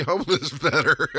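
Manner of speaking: breaking into laughter mid-sentence; laughing. Real, not acted. Comic offering coaching on being homeless, laughing. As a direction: crack up at your own joke.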